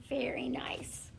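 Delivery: soft breathy whisper of praise. Real, not acted. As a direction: whisper it like a little secret compliment. Teacher's quiet aside to class.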